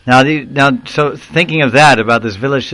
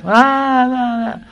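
high, drawn-out imitated wail; mock wailing; 13 semitones higher, much slower than usual. Preacher imitating the mother's wail over her son. Wife wails at son's death.

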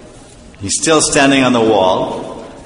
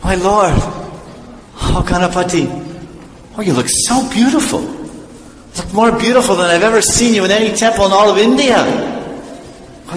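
awestruck devotee; 5 semitones higher than usual. Acted. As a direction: marvel reverently in a raised, wondering pitch. Devotee finally beholds his deity.

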